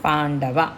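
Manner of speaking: పాండవ, పాండవ with the ం as a retroflex ణ, very slow; retroflex n in pandava. Shown as a demonstration. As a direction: drag out పాండవ with a clear ణ before డ. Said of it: "అణ". (పాండవ లో ణ స్పష్టంగా, నెమ్మదిగా పలకండి)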